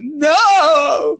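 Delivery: high, shouted no; kid's shouted denial. Retold or imitated. Kid refusing to hear Santa is fake, shouting. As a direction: bark it like a stubborn kid.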